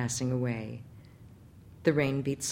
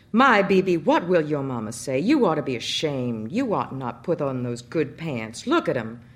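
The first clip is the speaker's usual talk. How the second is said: loud, exasperated scolding; 5 dB louder than usual. Acted. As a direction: scold the muddy boy. Father scolding muddy son.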